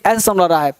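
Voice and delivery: normal talking voice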